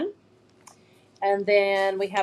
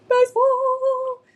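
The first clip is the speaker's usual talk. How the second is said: long, drawn-out high cheer; 7 dB louder, much slower than usual. Acted. Showing baseball decals to viewers, stretched high cheer. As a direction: shout it like a stadium crowd.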